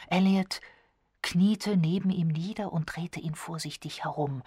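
plain reading voice

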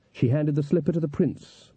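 ordinary delivery, nothing marked

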